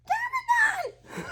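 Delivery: put-on tiny, squeaky drawn-out baby voice; extremely high baby impression. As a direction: squeal it as high as you can, softly. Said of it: "a baby even comes close saying".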